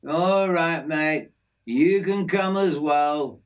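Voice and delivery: easygoing outback mate showing off his aviary; hint of a broad Australian working man; Aussie bloke Jim. Jim, an Aussie bushman, talks.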